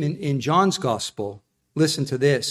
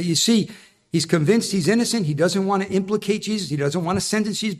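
usual speaking voice

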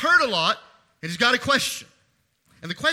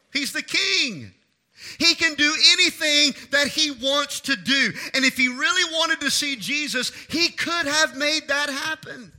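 higher, driving sermon delivery; impassioned preaching; 7 semitones higher, 5 dB louder than usual. Real, not acted. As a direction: preach it out with rising conviction.

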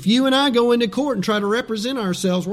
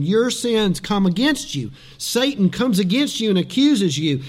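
usual speaking voice

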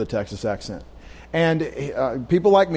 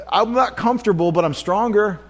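ordinary delivery

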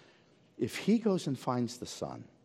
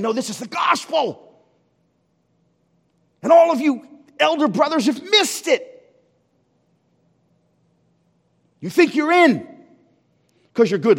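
loud, high proclamation; preaching shout; 13 semitones higher, 13 dB louder than usual. Acted. Jesus rebuking the elder brothers with a loud declaration. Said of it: "And Jesus is saying".

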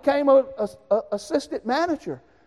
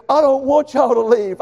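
loud plea; 4 semitones higher, 8 dB louder than usual. Retold or imitated. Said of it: "He said".

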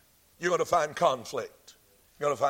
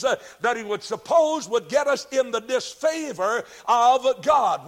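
emphatic preaching; 4 semitones higher, 4 dB louder than usual. Real, not acted. Preacher proclaiming war in heaven to his congregation, emphatic preaching.

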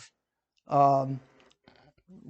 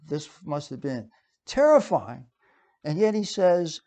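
normal talking voice

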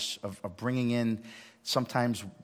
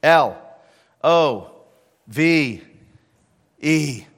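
each letter loud and separate; spelled out loud; 10 dB louder, much slower than usual. Acted. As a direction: punch L O V E one letter at a time. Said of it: "Number two".

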